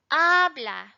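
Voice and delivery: "habla" with a loud, long first syllable; stress on first syllable. Shown as a demonstration. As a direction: lean hard on the "ha" of "habla". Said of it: "It's in this first syllable".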